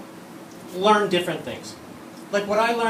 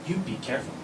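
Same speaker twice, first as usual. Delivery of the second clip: quiet threat; 4 semitones lower, 7 dB quieter, much faster than usual. Retold or imitated. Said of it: "she looks at me and she goes".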